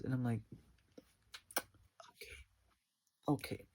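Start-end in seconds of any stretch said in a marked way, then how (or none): 2.1-2.4 tiny voiceless whisper; barely audible acted reaction. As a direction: whisper it under your breath. Acted reaction, whispered first take.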